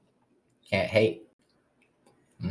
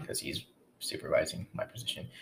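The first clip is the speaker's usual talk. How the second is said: whispered aside; 4 semitones lower, 11 dB quieter than usual. Real, not acted. Muttered aside about the boss.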